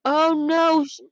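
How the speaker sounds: ordinary delivery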